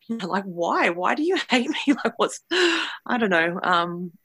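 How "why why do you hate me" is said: retold protest, pitched high with a laugh; pleading question. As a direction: ask why in a high voice, half laughing. Retold plea to the hostile voice.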